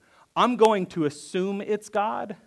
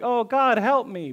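"Oh, God, help me" pitched higher, a light plea; mild plea; 7 semitones higher, 3 dB louder than usual. Retold or imitated. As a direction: voice a quick first-time cry for help. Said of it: "Like when you say it one time".

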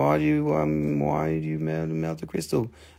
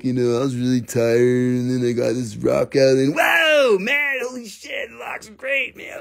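stoned dude. Retold or imitated. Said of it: "And I was like".

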